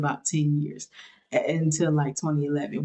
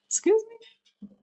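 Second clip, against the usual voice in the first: high, sharply rising mock offence; mock shocked excuse me; faster than usual. Reviewer mocking a character's reaction, high and offended. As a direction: act scandalised at the line, pitched way up. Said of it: "And it was just like".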